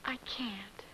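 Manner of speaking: unvoiced, breathless whisper. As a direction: breathe the refusal out. Aunt refuses after coughing.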